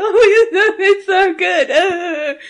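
high, loud, drawn-out mock sob; hammed-up weepy wail through laughter; happy tears. Fan telling co-host about the ending, happy tears. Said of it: "I had happy tears at the end".

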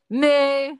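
loud cow moo as buzzer; loud mock moo buzzer. Buzzer sound in quiz.

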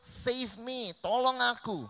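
high, pleading cry, the last plea loud and slow; desperate cry for help. Acted. Peter sinking in the waves, crying out to Jesus to save him. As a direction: beg to be pulled from the water. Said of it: "lalu berteriak".

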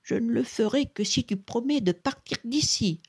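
put-on high, insistent old beggar; stern condition. A narrator's reading. Beggar sets his condition firmly.

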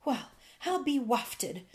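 mild exasperated exclamation; a touch of put-out disbelief; disgusted. A narrator's reading. Fairy ignored by the old man, mildly disgusted. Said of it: "said the fairy in disgust".

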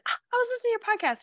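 very high, quick quote; imitated excited friend; quoted enthusiasm. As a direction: read the friend's text in a perky squeak. Quoting a friend's text.

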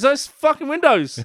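high, loud indignant call-out; loud exclaim. Retold or imitated. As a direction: shout it across the room. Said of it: "someone goes".

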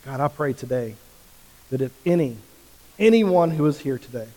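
praying aloud. Acted. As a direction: lead the room in earnest prayer. Leads congregation in prayer.

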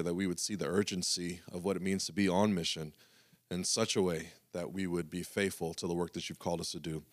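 hushed, reverent prayer voice. Acted. Pastor praying over the congregation, hushed and reverent.

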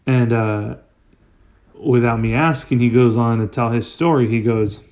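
ordinary talk, nothing marked

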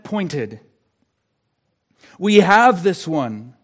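building pulpit refrain, each phrase driven home; emphatic, rhythmic declaration to the congregation; impassioned preaching. Real, not acted. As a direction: proclaim it with mounting force.

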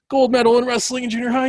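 high, loud, fast boast; bragging. Real, not acted. Guest boasting to the host about his coach, proud loud flex. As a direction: show off the win proudly. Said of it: "little flex".

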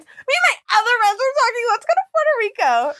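high-pitched mimicry of a co-host; mocking a friend. Mocking her friend's travel story, high-pitched imitation.

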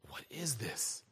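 low, very soft whisper; hushed whisper. Acted. Preacher voices the healed man asking what happened, hushed whisper. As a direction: whisper it, as the man freed of the spirit.

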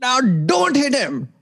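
high, hammed-up Indian accent, slowing on the punchline. Comedian retelling his first joke, Gandhi coach in Indian accent. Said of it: "Was the accent as good then".

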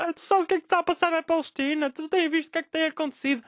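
high, sneering imitation of a lecturing friend; mocking preachy friend. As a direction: mimic the know-it-all smugly. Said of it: "atiram esta porcaria destes argumentos".

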